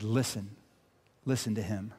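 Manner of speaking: hushed, grave imperative; quiet solemn command. Retold or imitated. Voicing God's hushed command.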